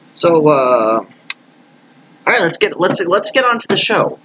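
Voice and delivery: high, upbeat show launch; excited host. Acted. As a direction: kick the show off with energy.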